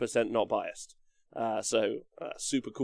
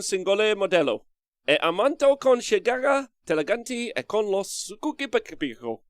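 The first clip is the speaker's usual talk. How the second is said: put-on Italian accent; 7 semitones higher, 3 dB louder than usual. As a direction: speak in an exaggerated Italian accent. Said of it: "Do the Italian accent? Yes".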